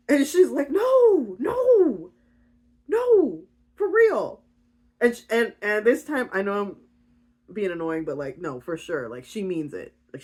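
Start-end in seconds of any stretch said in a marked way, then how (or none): 0.7-4.4 loud, high wailed denial; hammed-up desperate reassurance; emphatic pleading. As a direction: wail each no imploringly. Rhea swears she will stay.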